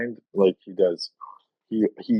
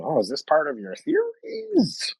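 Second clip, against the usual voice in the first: higher, sarcastic faux-innocent question; mocking; 10 semitones higher than usual. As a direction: tease them with mock innocence. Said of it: "you just throw it right back at them".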